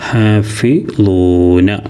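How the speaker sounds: ഹാഫിളൂന very slow, ളൂ stretched; teacher modelling ഹാഫിളൂന slowly; long ളൂ sound. Shown as a demonstration. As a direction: hold the ളൂ in ഹാഫിളൂന. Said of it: "ലൊമ്മിനെ നീട്ടാൻ വാവ്".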